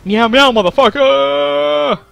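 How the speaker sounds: loud, high, drawn-out meowing yell; mock battle yell. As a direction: yell it out slowly like a silly war cry. Battle cry at the game.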